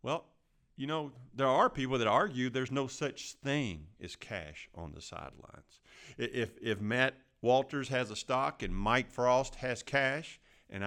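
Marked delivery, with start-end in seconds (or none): none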